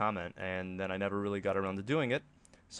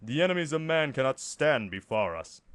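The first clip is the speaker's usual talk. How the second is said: Space Marine voice; 5 semitones higher, 6 dB louder than usual. Shown as a demonstration. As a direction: bark it like a space soldier. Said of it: "That was really bad".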